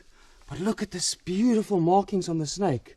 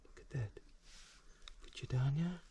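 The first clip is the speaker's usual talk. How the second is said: hushed pleading; 2 semitones lower, 16 dB quieter than usual. Real, not acted. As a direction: coax it quietly, afraid of a bite. Stroking the snake's head.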